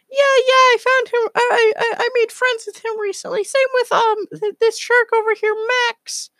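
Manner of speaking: very high, squeaky squirrel voice; Space Squirrel. Acted. Space Squirrel tells his friend about new companions, very high squirrel voice. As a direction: chatter in a tiny, piercing falsetto. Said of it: "Space Squirrel's like".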